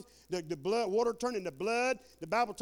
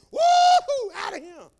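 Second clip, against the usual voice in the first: high, loud whoop of escape; jubilant whoop; 12 semitones higher, 13 dB louder, slower than usual. Pretend. Preacher acting out the rapture trumpet moment, jubilant whoop. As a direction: whoop as if taking off skyward. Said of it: "That's what it's gonna be".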